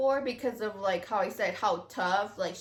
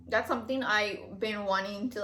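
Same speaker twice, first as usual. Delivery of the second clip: normal talking voice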